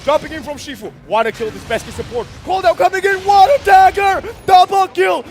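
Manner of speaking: excited esports caster call; play-by-play hype. Real, not acted. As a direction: shout the fight call live.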